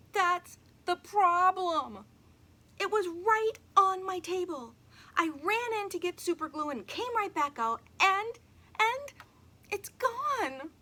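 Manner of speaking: put-on a little girl, panicking and high; upset girl. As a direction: wail it out, desperate. Emily upset, flower gone.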